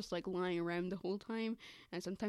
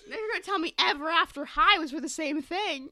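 higher and louder, half-laughing protest; outraged disbelief; 7 semitones higher, 9 dB louder than usual. Real, not acted. Reacting to her friend's news with laughing outrage.

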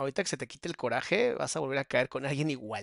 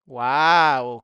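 sarcastic wow; 2 semitones lower, 10 dB louder than usual. Real, not acted. Reacción burlona al mensaje leído.

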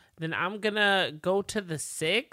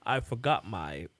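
lower, slow, trailing off; low, slow mumble; 8 semitones lower, 4 dB quieter, slower than usual. Retold or imitated. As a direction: mutter an unfinished excuse. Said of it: "'cause he goes".

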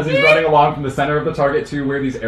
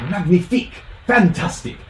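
put-on French accent; 5 semitones higher than usual. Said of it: "I'm gonna change accents frequently".

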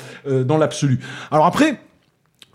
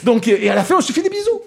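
mocking Toulouse accent; 8 semitones higher, faster than usual. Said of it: "je caricature avec mon accent toulousain".

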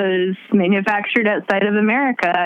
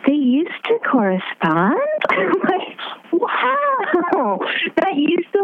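gasping, high, slow disbelief; stunned, voice cracking upward; shocked disbelief; 10 semitones higher, much slower than usual. Real, not acted. Shocked realization mid-conversation.

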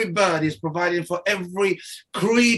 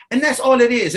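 ordinary delivery

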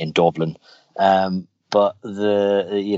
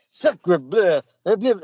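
high cartoon voice exclaiming in French; Bart; 12 semitones higher, much slower than usual. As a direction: cry out French in a put-on high voice. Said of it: "started talking in French".

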